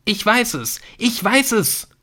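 very high cry of discovery; voice cracking, shouting. A narrator's reading. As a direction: shout it twice, voice tipping over. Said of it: "Seine Stimme kippte". (sich überschlagender Jubelschrei)

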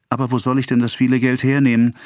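high, fast, plaintive wail; acted helpless lamenting. A narrator's reading. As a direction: rush it out as a distressed plea. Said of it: "jammerte Emma und rang die Hände".

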